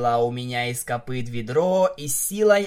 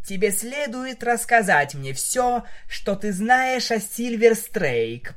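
higher-pitched pointed demand; understated menace; 9 semitones higher than usual. A narrator's reading. Vinyl interrogates the captive, understated menace. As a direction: press the captive with restrained threat. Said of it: "процедила Винил".

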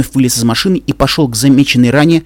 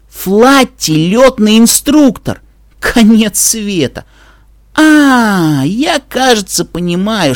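hammed-up despair; 7 semitones higher, slower than usual. Dash refuses to believe it.

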